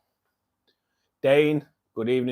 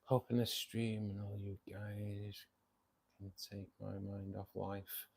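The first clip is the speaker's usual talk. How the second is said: slow, hushed whisper to the chat; 4 semitones lower, 17 dB quieter than usual. Real, not acted. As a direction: breathe it out softly, worn down. Weary wish after hospital story.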